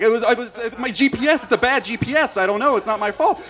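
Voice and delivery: loud, high, flustered excuse; freaking out. Retold or imitated. Driver defending himself to his friend, freaking out. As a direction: imitate panicked, stumbling self-defence. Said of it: "we started freaking out".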